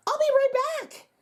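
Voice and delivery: very high, held singsong; sing-song exit. Acted. Magician hurries out the door, high singsong. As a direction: chirp it on your way out.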